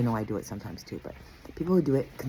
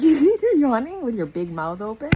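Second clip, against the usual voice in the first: loud laughing question; 11 semitones higher, 11 dB louder than usual. Retold or imitated. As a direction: laugh through it, loud and high. Mocking dad's yawning trick.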